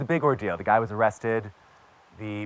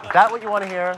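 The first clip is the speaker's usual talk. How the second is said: higher, louder teasing challenge; sarcastic jab; 9 semitones higher, 6 dB louder, faster than usual. Real, not acted. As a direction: throw it back at them with a smirk.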